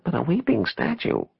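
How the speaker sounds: high-pitched, dubious query; high, skeptical churchwoman; doubtful question. A narrator's reading. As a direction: question it with a crinkled nose. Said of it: "Blanche asked, making a crinkled face".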